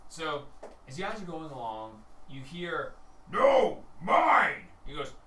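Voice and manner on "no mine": high, very loud possessive yell; full-force acted shout; angry orc quarrel. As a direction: bellow like a squabbling orc. GM voices orcs quarrelling over kill.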